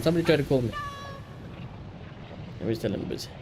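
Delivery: ordinary talk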